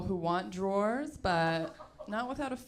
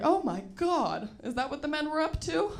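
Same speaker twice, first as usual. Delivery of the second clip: mock innocent; 8 semitones higher than usual. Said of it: "I'm always like".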